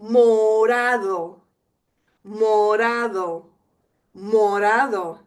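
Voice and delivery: Morado said slowly, every vowel clear; slow model word to repeat; slow drill. Shown as a demonstration. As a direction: draw out Morado for learners. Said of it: "we have three vowels".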